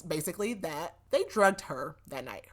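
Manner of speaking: ordinary talk, nothing marked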